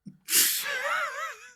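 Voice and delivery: high-pitched giggle; real laugh. Real, not acted. Host tickled by his guest, real high laugh.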